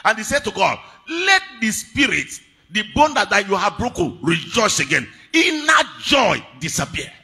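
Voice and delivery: high, loud, impassioned sermon; full-force preaching. Acted. Preacher voicing David's plea to God, full-force preaching. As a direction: cry the plea out to the congregation.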